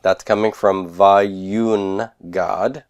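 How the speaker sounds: ordinary talk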